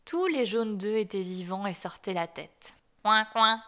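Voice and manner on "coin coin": loud quacking coin coin from the mother duck; loud duck's quack; duck quack call. A narrator's reading. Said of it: "dit la canne".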